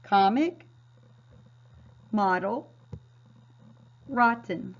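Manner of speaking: each word said alone, short ah sound in the first syllable; modeled reading. Shown as a demonstration. Teacher reads short-O words with class, clearly. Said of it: "So let's read these words together".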